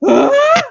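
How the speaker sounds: high-pitched shriek of panic, loud; retold scream. Mother in labour reliving her shriek of panic. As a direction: shriek it out as if suddenly terrified. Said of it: "I was like".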